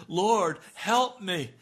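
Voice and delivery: screeching plea. Pretend. Preacher imitating addicts crying out, a loud plea. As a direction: scream out for help.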